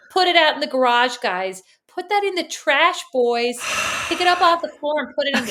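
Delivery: high, mocking American accent; hammed-up American calling to the boys. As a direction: imitate your sister gone American. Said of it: "I heard you with an American accent".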